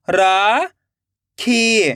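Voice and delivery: राखी split into रा, long pause, खी; rising रा, then falling खी; syllable by syllable. Shown as a demonstration. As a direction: sound out rakhi very slowly in two parts. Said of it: "एक एक अक्षर का उच्चारण करना है".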